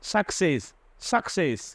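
অ্যাকসেন্ট said খ then স. Shown as a demonstration. Teacher showing both sounds of the double c. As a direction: split অ্যাকসেন্ট into খ and স. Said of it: "সিটা হয়েছে প্রথমে খ".

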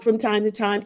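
ordinary talk, nothing marked

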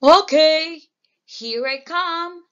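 big goat voice. A narrator's reading. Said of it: "says the very big goat".

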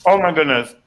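ordinary delivery

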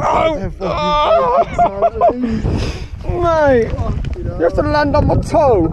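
pained yell. Real, not acted. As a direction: shout as it hits your toe. Drone lands on his toe.

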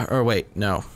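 ordinary talk, nothing marked

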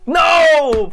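loud, high cry of dismay; anguished shout. Real, not acted. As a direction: yell as the hit lands. Losing fight in game.

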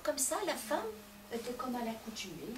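usual speaking voice

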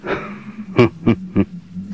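soft unvoiced villain laugh; hushed smug chuckle; breathy chuckle. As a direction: exhale a quiet smug laugh. Villain laughing after attack.

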